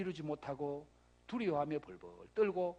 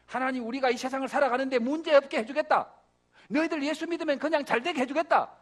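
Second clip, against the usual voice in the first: loud, raised pulpit voice; full-force preaching; 6 semitones higher, 8 dB louder than usual. Real, not acted. Preacher challenging believers' hopes of an easy life, full force. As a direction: hammer it out to the congregation.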